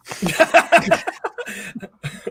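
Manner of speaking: high, sustained laughter; real laugh. Real, not acted. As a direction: keep cracking up. Laughing at the joke.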